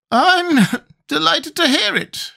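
very high, slow squeak with a little cough; Gump with slight cough. A narrator's reading. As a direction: squeak it slowly round a small cough. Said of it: "with a slight cough".

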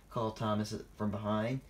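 ordinary delivery

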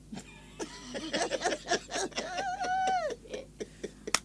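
very high squealing laugh that tips into crying; shrieking laugh. Real, not acted. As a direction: laugh so hard it turns into a wail. Laughing at the birth joke.

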